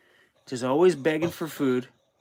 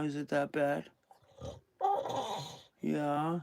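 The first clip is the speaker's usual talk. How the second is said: soft teasing coo; 10 dB quieter than usual. Real, not acted. Owner softly teasing his whining dog.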